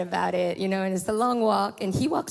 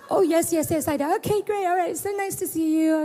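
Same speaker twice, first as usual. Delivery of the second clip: bright high chatter; 9 semitones higher than usual. Retold or imitated. Voicing the girl and pastors.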